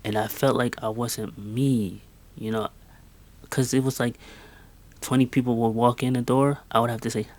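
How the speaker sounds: normal talking voice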